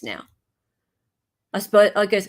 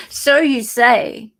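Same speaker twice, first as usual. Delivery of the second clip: P=A; laughing; 3 semitones higher, 8 dB louder, much slower than usual. Real, not acted. Teasing reply to chat.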